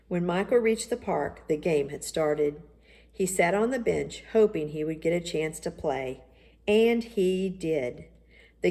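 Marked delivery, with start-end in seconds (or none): none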